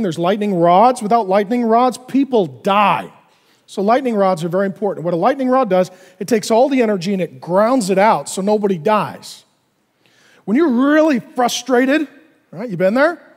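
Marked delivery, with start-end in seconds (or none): none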